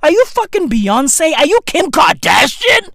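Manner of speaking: normal talking voice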